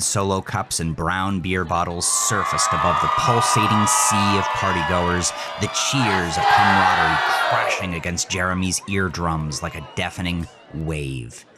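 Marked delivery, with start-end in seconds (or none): none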